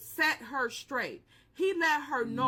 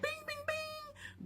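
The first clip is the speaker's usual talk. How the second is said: phone chime; 10 dB quieter, slower than usual. Retold or imitated. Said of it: "He was like".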